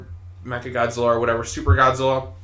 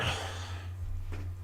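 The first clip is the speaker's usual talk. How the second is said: exasperated sigh; 15 dB quieter than usual. Real, not acted. Exasperated reviewer gives up.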